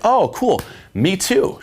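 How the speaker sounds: high-pitched, upbeat mock surprise; feigned excitement. Man tells a girl he's Canadian too, feigned excitement. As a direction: play up the delighted coincidence.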